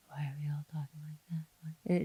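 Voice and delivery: mock whispery, barely voiced mumble; mumbling actor. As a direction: imitate someone speaking far too quietly.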